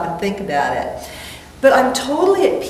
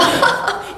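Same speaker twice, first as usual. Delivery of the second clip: a light laugh at herself; 13 semitones higher than usual. Real, not acted.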